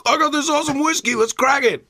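high, fast excited bro voice; excited partygoer. Retold or imitated. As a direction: hype it up like a party guest. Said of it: "people are like".

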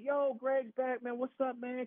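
high-pitched imitation of pals; retold hyped-up welcome; excited greeting. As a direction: hail a returning buddy. Imitates old friends greeting him.

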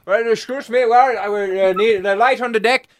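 officer bursting in, lightly Dutch; Dutch accent. Pretend. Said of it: "do the Dutch accent".

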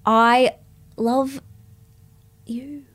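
very slow, halting, dragged out, trailing to a murmur; reluctant confession. Acted. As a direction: force each word out against your will. Forced confession.

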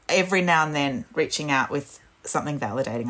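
ordinary talk

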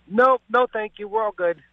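high-pitched, airy brush-off; mock polite refusal. Acting out own refusal of scammer.

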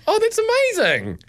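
high-pitched mock child's amazement; delighted child. Son to dad after the cuddle explanation, delighted. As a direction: imitate a little boy's wide-eyed delight.

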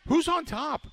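fired-up talk radio host; excited rhetorical question. Real, not acted. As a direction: fire it at the listener like a challenge.

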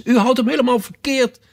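high, urgent cry; calling out. A narrator's reading. Alice sees the brooch held wrong, crying out. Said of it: "riep Alice".

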